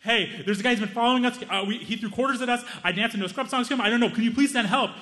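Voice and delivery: a touch of panic on the phone to the police; panicked call. Retold or imitated. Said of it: "I'm like".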